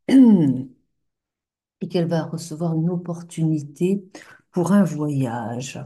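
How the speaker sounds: ordinary talk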